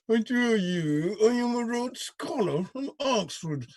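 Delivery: mock a posh Rhodes Scholar, slowly through the teeth; clenched-teeth Oxford voice. Host mocking the senator's old Oxford voice through clenched teeth. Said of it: "He used to clench his teeth as he spoke".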